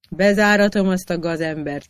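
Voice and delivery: ordinary delivery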